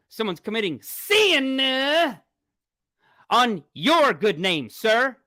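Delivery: mock solemn. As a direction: lay it on like a stern preacher.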